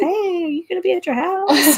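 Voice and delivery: high, loud, laughing caller voice; mock adjuster voice. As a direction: imitate the adjuster's cheery call, laughing. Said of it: "he calls and goes".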